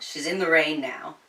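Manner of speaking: ordinary delivery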